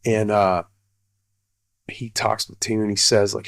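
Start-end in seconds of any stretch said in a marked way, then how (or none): none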